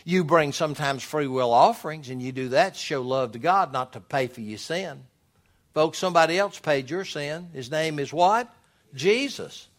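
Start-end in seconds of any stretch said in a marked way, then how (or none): none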